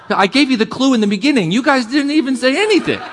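high, quick, playful scolding; mock exasperation. Teasing congregation for not answering.

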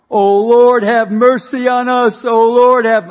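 loud, high pleading cry to the Lord. Retold or imitated. Christians on their faces crying out for mercy, loud and high. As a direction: wail it like a crowd begging for mercy. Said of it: "screaming out loud".

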